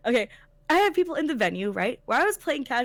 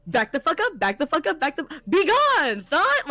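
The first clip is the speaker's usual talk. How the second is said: building from firm to a high, loud slow banishing cry; hammed-up exorcist banishing a pest; retold order that swells into a slow, high, loud yell; building mock shout; 4 semitones higher, 6 dB louder than usual. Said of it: "Pulled her slightly off of him".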